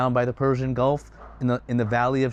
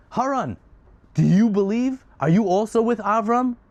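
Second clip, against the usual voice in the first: high, loud challenge; furious demand; 8 semitones higher, 4 dB louder than usual. Acted. Furious king turns on the next brother, high angry demand. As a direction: confront him angrily, pitched well up.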